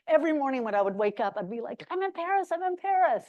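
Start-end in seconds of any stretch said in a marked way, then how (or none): 1.9-3.2 high, thrilled sing-song; a rapturous little chant to herself; giddy delight. Retold or imitated. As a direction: relive the morning joy of waking up in Paris. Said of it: "literally saying that out loud".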